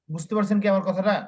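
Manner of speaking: normal talking voice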